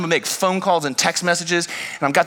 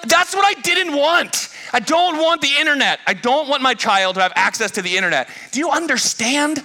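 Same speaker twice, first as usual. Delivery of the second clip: frustrated outburst; 9 semitones higher than usual. Retold or imitated. Dad pleading with the Verizon clerk. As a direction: lay it out in rising frustration. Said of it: "I'm like".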